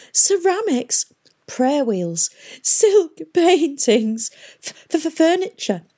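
high, quavering list with stammer; distressed lament. Acted. Historians fret over treasures facing destruction, distressed. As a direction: mourn each treasure in turn, drawn out.

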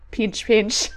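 playful claw-snipping crab voice. Pretend. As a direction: snap it out like a little crab. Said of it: "I like your crab voice".

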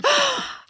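high, sharp swooning gasp; delighted gasp. Retold or imitated. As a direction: gasp in mock amazement. Swooning at husband's quote.

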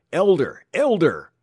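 loud, high repeated yell; desperate high shout; screaming. A narrator's reading. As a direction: scream your own name to be recognized. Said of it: "screamed the clerk".

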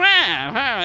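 squawked creature noise; Krang-like wail. Retold or imitated. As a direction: imitate a cartoon creature's "wah". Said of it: "he's like Krang. He's almost like Krang from the Turtles".

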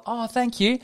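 high, put-on grateful squeal; fake gratitude. Acting out receiving a wrapped gift, fake gratitude. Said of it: "eyebrows raised".

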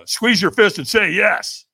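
loud, slow firm instruction; coaching command. Retold or imitated. Trainer coaching him at the fire lane. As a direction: push him to commit. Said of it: "the trainer goes".

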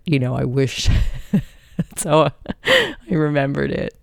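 ordinary talk, nothing marked

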